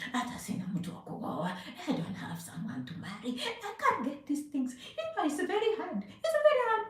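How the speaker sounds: soft, mocking whine of someone making excuses; mock complainer. Mimicking a viewer who says they cannot marry: soft mocking whine.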